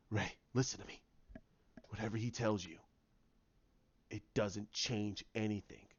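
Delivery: low, soft and grave; hushed, serious reassurance; hushed pleading. Acted. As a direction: speak low and quietly, urging her to listen. Luke urgently reassures Rey.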